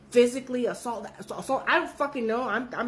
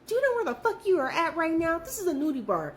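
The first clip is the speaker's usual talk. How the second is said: scolding friend; 8 semitones higher than usual. Retold or imitated. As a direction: tell her off with a laugh. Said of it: "I'm like".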